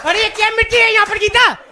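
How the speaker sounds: भारतीय पति नौकरानी पर चिल्लाता हुआ; bossy Indian husband. Retold or imitated. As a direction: bark orders across the house. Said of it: "when you go home".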